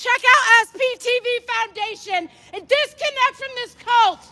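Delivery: high, slow projected call-out; protest call. Real, not acted. Protester addressing passers-by, projected call-out. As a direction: call it out to the whole street. Protester announcing over the crowd.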